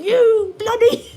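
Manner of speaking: high, loud, slow mock British shout of you bloody; mock British yell. British people screaming at each other, mocked as a yell. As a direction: ham up a British insult, dragging it out. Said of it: "screaming at each other".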